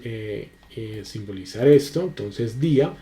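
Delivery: normal talking voice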